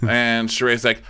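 ordinary talk, nothing marked

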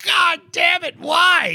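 retold scream of pain on the examining table. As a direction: yell it out as if the hernia is being shoved in. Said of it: "You know, just screaming".

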